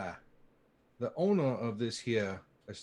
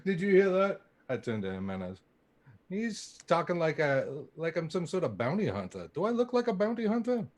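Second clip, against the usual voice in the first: indignant; 6 semitones higher, 6 dB louder than usual. Acted. Player indignant at being misjudged.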